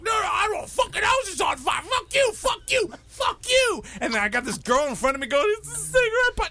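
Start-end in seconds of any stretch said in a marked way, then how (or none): 0.0-3.8 high, furious shouted quarrel; yelling at each other. Retold or imitated. Family yelling at each other, high furious shouting. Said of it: "everyone is just fucking yelling at each other". 5.6-6.5 high tearful wail; crying. Retold or imitated. Girl to narrator, high crying. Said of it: "And she's crying".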